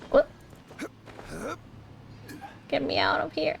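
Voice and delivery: usual speaking voice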